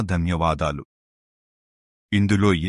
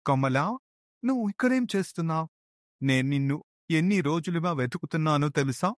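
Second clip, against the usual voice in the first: surprised; 7 semitones higher, 5 dB quieter than usual. Acted. Husband suddenly finds lost wife.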